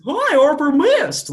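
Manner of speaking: high, loud, drawn-out mock greeting; hammed-up high-pitched hello; mock squeal. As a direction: squeal it slowly in an exaggerated put-on voice. Mocking a cheap sweet wine.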